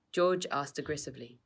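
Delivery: ordinary delivery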